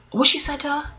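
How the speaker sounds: ordinary talk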